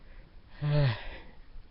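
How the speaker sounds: long falling sigh; tired sigh. Acted. Sunset woken at night, sighing tiredly. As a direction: sigh it out, weary.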